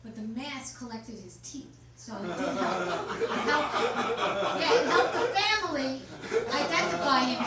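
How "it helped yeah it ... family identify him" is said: punchline. Acted. Punchline pushed louder.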